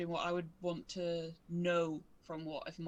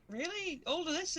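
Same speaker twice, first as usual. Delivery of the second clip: high, incredulous gasp; mock astonished; 9 semitones higher than usual. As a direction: act amazed, much higher.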